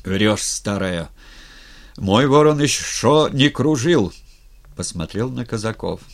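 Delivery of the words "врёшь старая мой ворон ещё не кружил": fierce defiance, building to a loud shout; ataman's enraged, defiant roar; loud and furious. A narrator's reading. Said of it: "сказал громко, остервенело".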